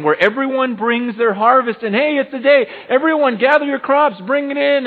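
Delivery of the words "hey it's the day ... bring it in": loud, high call to the whole crowd. Acted. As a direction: announce the harvest day like a town crier. Calling everyone to the harvest.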